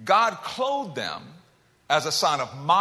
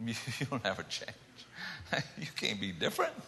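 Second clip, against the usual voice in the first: low, much softer taunting voice; mocking inner voice; 9 semitones lower, 11 dB quieter than usual. Inner demonic voice taunting the listener, low and soft. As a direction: murmur it like a voice inside your head. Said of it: "those voices say".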